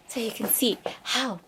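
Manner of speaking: soft whisper about the shoes. Real, not acted. Showing the shoes to viewers, soft whisper. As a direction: whisper it close.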